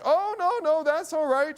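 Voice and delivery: high-pitched voicing of an older woman. Older woman to worried father, high-pitched reassurance. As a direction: wave off the worry in a high old lady's voice.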